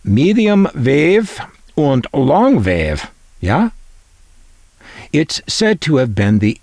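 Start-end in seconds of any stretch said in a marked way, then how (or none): 0.0-3.7 higher, slow, hammed-up German accent; mock German accent. Non-German speaker guessing the band names, mock German accent. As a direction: put on a playful German accent, stretched out. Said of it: "I don't speak German".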